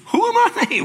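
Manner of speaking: very high, loud squeak; squeaky high. Shown as a demonstration. Moses asking God in a squeaky panicked voice.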